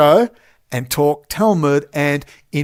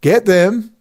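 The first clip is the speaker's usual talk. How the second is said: louder, higher, pushing it out defiantly; loud again; 5 semitones higher, 5 dB louder than usual. Real, not acted. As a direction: say it loud on purpose. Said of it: "Yes, I'm talking very loud".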